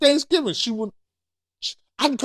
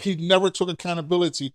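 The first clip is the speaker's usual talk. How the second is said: usual speaking voice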